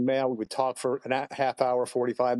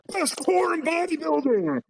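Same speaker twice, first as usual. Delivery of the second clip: hammed-up booming voice, pitched way up; booming stage voice. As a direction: belt it out like a big stage announcer. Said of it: "all you hear is this deep, booming voice".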